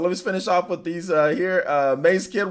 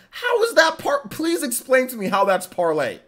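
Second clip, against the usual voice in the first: exasperated disbelief; 8 semitones higher than usual. Real, not acted. Streamer asking a viewer to explain a spelling, exasperated disbelief. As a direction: pitch jumps up in bafflement.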